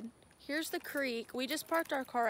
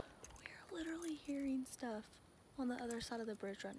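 hushed scared whisper; whisper, afraid of being heard; 15 dB quieter than usual. Real, not acted. Listening for ghost cries at creek.

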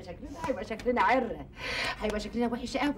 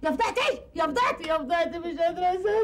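higher, louder keening; high wailing; 6 semitones higher, 4 dB louder than usual. Acted. Woman keening at the family that she cannot believe it. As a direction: wail as if the world has ended.

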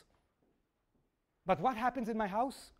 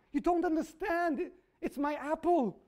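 mock upset wife; 7 semitones higher than usual. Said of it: "she starts crying".

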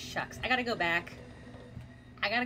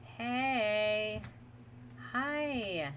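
ordinary talk, nothing marked

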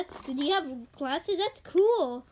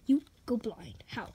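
shocked whisper; 8 semitones lower, 6 dB quieter than usual. Acted. Friend reacts to blindness news.

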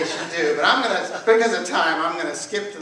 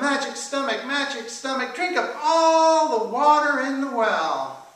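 high, incantatory call; high-pitched rooster summoning a spell; 8 semitones higher, 5 dB louder than usual. A narrator's reading. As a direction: call up the magic, stretching the last command. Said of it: "the rooster called out".

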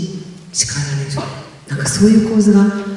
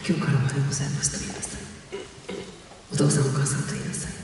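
fast, softer replay of the rules; hurried quiet echo of a stern father; rattled-off father's orders; 3 semitones lower, 13 dB quieter, much faster than usual. Retold or imitated. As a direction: run through dad's rules quickly under the breath. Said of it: "父が先ほどのような".

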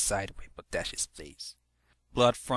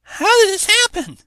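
alarmed shout; 16 dB louder than usual. Acted. Ponies demanding answers.

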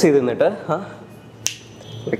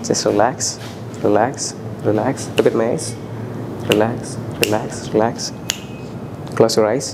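hypnotic induction; 2 semitones lower, much slower than usual. Acted. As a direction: lull him toward sleep, word by word.

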